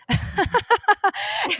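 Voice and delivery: a burst of high laughter; bright, bubbling real laugh. Real, not acted. Laughing at her own impression.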